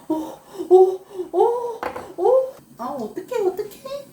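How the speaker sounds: high laughing squeal; startled squeal. Real, not acted. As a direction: yelp in laughing alarm. Something spills or boils over.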